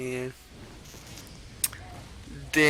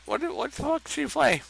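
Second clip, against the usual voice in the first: baffled; 9 semitones higher, 4 dB quieter, faster than usual. Real, not acted. Surprised at opponent's play.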